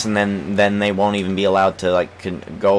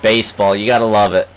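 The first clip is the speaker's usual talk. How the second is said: ordinary talk, nothing marked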